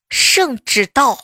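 slow drawn-out eunuch announcement; 太监宣旨. Retold or imitated. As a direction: drag it out like a court herald. 爸爸来电显示圣旨到. (拖长腔的太监宣旨)

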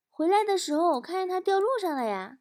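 put-on high, innocent little-kid voice; nonchalant child. Son calmly tells mum where phone fell, childlike nonchalance. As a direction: report it lightly, untroubled. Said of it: "无比淡定地说".

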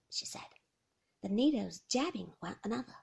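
ordinary talk, nothing marked

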